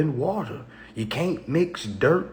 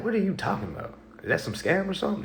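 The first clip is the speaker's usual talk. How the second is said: mock baffled reaction; 6 semitones higher, 5 dB quieter, much faster than usual. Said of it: "a person think like".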